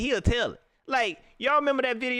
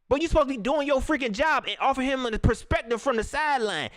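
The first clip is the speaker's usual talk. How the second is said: usual speaking voice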